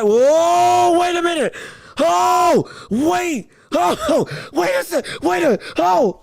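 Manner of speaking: high, loud, startled wait a minute; real shock, pitch jumping up; stunned disbelief. Real, not acted. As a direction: caught off guard by the news. Shocked by coach firing news.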